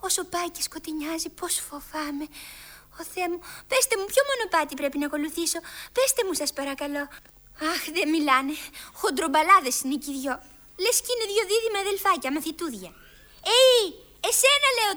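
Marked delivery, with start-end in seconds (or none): 0.1-3.4 hushed fear. Acted. Alice scared in dark forest. 13.6-14.9 calling out. Acted. Alice calls a twin over.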